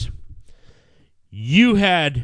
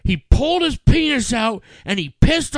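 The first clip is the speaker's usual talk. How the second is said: outraged; 10 semitones higher, 4 dB louder, slower than usual. Retold or imitated. Retelling wife's outraged report.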